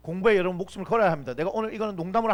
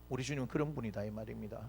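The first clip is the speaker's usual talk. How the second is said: ordinary talk, nothing marked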